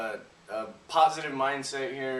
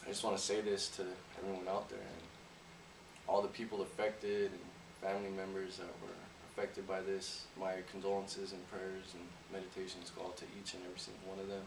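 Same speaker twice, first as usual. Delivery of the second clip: somber condolences; 5 semitones lower, 12 dB quieter than usual. Real, not acted. Condolences for bombing victims.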